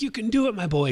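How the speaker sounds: a hint of the pirate playing fond father; Hook cheering. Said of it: "Hook is up there being like".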